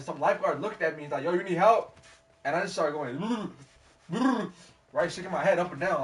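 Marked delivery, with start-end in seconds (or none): 1.2-2.0 high, loud shouted question; lifeguard calling out. Retold or imitated. Lifeguard to struggling swimmer, loud acted call. 4.1-4.6 high, loud mock gurgle; struggling noise, head going under; imitated drowning noise. Swimmer answering the lifeguard, mock drowning splutter.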